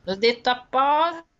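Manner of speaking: loud emphatic insistence; insistent. Real, not acted. Repeats insisting she said it on purpose.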